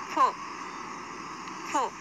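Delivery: फो with teeth on the lip, a hissing f. Shown as a demonstration. Teacher shows how to say फो with teeth and lip. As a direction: press teeth to lip and blow फो. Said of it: "हमारे दांत और ओठ जुड़ते हैं".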